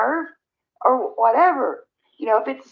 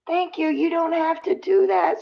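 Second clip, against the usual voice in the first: higher, tender, slowed and emotional; touched thanks; 6 semitones higher, slower than usual. Real, not acted. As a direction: thank her, moved close to tears. Thanking a viewer for gifts.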